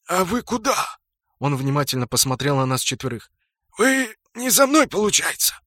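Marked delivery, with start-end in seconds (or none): 0.1-1.0 high-pitched wary question; puzzled orc. A narrator's reading. Orc asks the four where they go, puzzled. 3.8-5.6 surprised orc. A narrator's reading. Orc realizes they are not hunting him, surprised.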